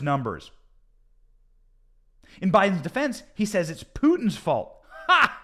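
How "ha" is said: one sharp, high, loud scoffing "ha"; scoffing laugh. Real, not acted. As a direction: bark out a single derisive laugh. Mocking Biden's Putin excuse.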